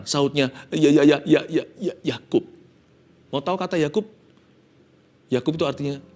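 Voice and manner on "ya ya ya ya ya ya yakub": zitternde, stockende Antwort; zitternd. Acted. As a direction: sag es mit bebender Stimme. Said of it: "penuh dengan getaran".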